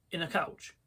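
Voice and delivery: ordinary delivery, nothing marked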